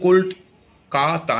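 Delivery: "Kolkata" slowly broken into কল, কা, তা; drawn-out three-beat "Kolkata"; split into syllables. Shown as a demonstration. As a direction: say "Kolkata" one syllable at a time. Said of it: "একে তিনটা ভাগে ভাঙা হয়েছে".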